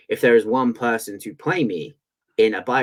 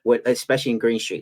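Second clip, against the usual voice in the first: ordinary talk, nothing marked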